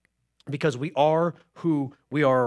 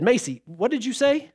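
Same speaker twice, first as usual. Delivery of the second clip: questioning parent; 6 semitones higher than usual. Retold or imitated. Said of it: "So finally we said".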